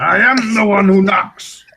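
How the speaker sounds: gruff TV villain impression; menacing Heisenberg voice.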